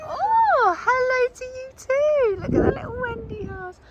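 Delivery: very high, gushing squeal of hello; delighted greeting. Real, not acted. Visitor spotting two animals and their Wendy house, squealing. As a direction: coo high at the little animals.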